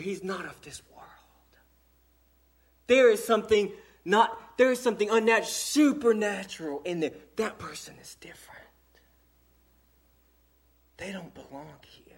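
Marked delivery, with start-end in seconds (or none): 0.0-0.9 "he's not of this world" whispered softly. Acted. Preacher voicing onlookers to the congregation, whispered. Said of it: "but they say". 7.6-12.0 whispered softly; hushed whisper. Acted. Preacher to his congregation, a whispered aside.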